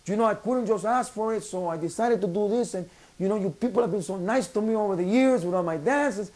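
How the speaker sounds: high-pitched pleading impression; Federico Pagani. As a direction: plead your case to the crowd in a put-on high voice. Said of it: "he quickly follows up and he tells him".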